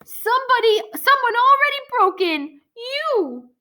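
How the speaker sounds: high, frantic accusing squawk; panicked bird creature. Acted. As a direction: point the finger in a panic. Scorp accuses Logan in panic.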